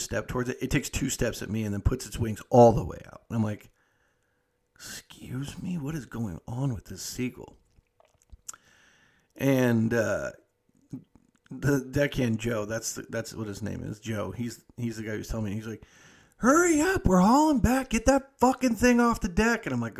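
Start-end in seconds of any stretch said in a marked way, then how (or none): 4.7-7.6 hushed confusion. Retold or imitated. Confused at the advancing bird. 16.4-19.7 high, loud hurry-up yell; high urgent shout across the deck; yelling deckhand. Retold or imitated.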